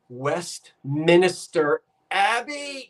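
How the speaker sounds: "Westminster" drawn out slowly with an extra syllable, West-minister; mispronounced Westminster. Retold or imitated. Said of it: "He kept saying".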